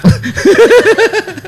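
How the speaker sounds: high-pitched burst of laughter. Real, not acted. Laughs after teasing remark.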